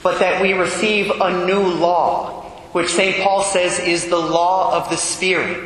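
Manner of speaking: ordinary talk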